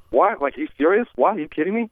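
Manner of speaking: high, incredulous questioning; Mark Wahlberg, questioning. Acted. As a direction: pitch each question up in disbelief. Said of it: "for Mark Wahlberg, it was questioning".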